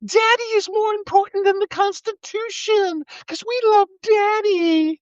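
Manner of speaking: high, slow, sneering mock-adoring voice; mock the worshippers in a silly high voice; sing-song sarcastic fan; mocking fanboy voice.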